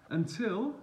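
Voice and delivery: ordinary delivery, nothing marked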